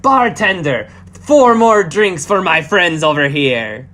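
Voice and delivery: loud, generous call across the bar; calling to bartender. Acted. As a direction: call out for a round. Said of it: "to the bar and just like".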